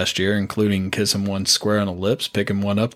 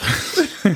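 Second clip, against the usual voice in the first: laughing; 12 semitones higher than usual. Real, not acted. Breaks up mid-sentence.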